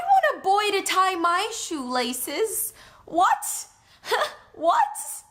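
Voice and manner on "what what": high, laughing "what? what?"; squealing with laughter at herself; laughing disbelief. Real, not acted. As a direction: giggle out the double "what". Gushing over shoelace scene.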